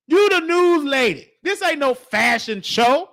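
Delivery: ordinary talk, nothing marked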